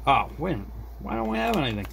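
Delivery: ordinary delivery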